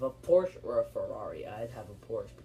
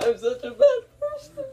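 squeaky high laughing confession; 10 dB louder than usual. Real, not acted. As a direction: laugh through it, pitch way up. Laughing at the awful choice.

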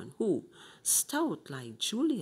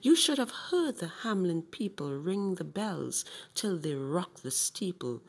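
usual speaking voice

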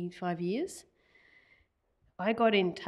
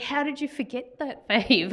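teasing cousin; 7 semitones higher, 5 dB louder than usual. Retold or imitated. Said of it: "And he's like".

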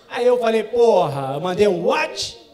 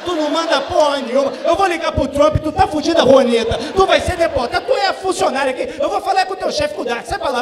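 high, loud, furious tirade; mock angry rant; 7 semitones higher, 8 dB louder than usual. Angry dad ranting at princess Leia, high loud fury. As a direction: tear into her like an outraged tourist. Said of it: "Aí tu fica puto".

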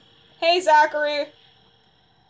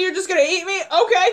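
ordinary talk

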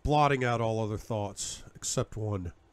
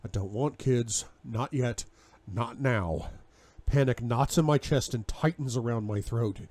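usual speaking voice

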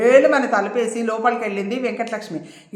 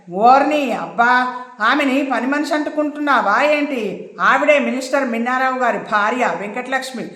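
ordinary delivery

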